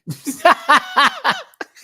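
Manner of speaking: loud, high burst of laughter; real cackling laugh at yourself. Real, not acted. Amused by the viewer's salad remark, laughing.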